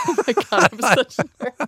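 high, laughing through the words. Real, not acted. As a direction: laugh while saying it. Laughing at her own joke.

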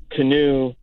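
usual speaking voice

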